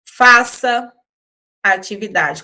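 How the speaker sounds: a loud, firm order on Faça; firm command. Shown as a demonstration. Teacher demonstrating an order to students, commanding. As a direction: tell the class to do it now. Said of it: "eu estou dando uma ordem".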